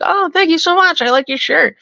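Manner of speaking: very high mock girly gushing; put-on high, sweet date voice; imitating a girl on a date. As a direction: pitch it way up, exaggerated politeness. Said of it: "the guy that she's not into would be like".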